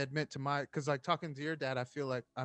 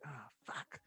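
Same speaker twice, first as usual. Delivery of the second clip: muttered curse; 13 dB quieter than usual. Retold or imitated. Dreading calling the deputy.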